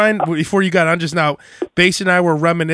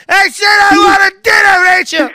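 husband bellowing at wife for dinner, mock whiny shout; hammed-up demanding husband; 8 dB louder than usual. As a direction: yell for your dinner like a spoiled husband. Acted couple quarrel.

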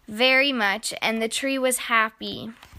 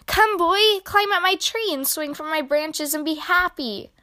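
joyful tree calling; 6 semitones higher than usual. Acted. The overjoyed tree calls the returning boy, higher and brighter. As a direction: call the boy back with delight.

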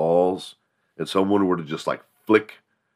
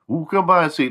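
much higher taunting voice; challenging classmate; 10 semitones higher than usual. Retold or imitated. Classmate daring the bragger to show the truck. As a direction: dare him to show it.

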